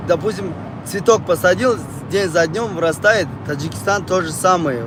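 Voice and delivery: normal talking voice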